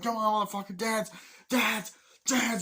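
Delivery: high, loud mock bullying yell; re-enacted jeering command; acted menacing taunt. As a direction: taunt him like a crazed soldier. Acts out soldier taunting villager.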